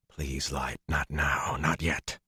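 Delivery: hushed, pleading prayer under the breath; desperate plea. Acted. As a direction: beg the Light quietly, barely voiced. Desperate silent prayer while escaping.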